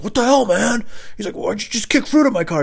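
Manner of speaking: a hammed-up angry driver. Angry driver confronts kicker.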